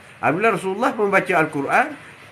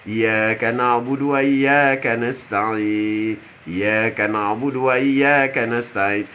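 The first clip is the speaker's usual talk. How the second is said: low, drawn-out chant; slow Quranic recitation; 8 semitones lower, much slower than usual. Acted. Preacher reciting in prayer before God, slow chant. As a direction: intone the verse slowly, facing God.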